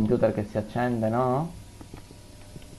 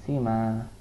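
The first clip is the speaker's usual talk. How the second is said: ordinary talk, nothing marked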